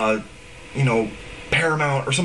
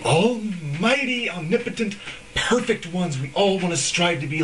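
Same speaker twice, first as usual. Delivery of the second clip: mock reverence; 4 semitones higher than usual. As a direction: lay the awe on thick, mocking. Mocking the idolized artists.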